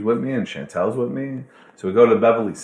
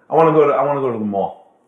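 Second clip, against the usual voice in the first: eager; 4 semitones higher, 6 dB louder, faster than usual. Retold or imitated. Eager demand to go shopping.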